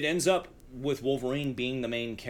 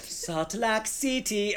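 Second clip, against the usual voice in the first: slow, high exaggerated accent; mock Storm accent; 8 semitones higher, much slower than usual. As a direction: stretch the words in a hammed-up accent. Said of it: "And I'm like".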